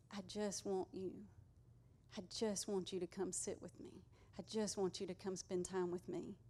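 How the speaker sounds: soft, gentle voice of God inviting; tender plea. Acted.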